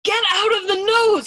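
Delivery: high, very loud scream; frustrated shriek. A narrator's reading. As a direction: shriek the order in sheer frustration. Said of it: "Yossarian shrieked in frustration".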